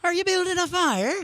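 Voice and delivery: put-on drunk pirate leprechaun. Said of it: "like a drunk pirate leprechaun".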